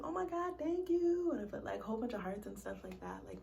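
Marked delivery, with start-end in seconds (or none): 0.0-1.3 much higher, delighted "Oh my God, thank you"; gushing thanks. Retold or imitated. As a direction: hype her up with a squeal. Imitating her gushing reply.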